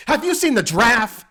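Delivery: loud, high mock scream; hammed-up shrieking impression; Billy Eichner scream. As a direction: bellow the question at a stranger. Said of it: "Billy Eichner's scream acting".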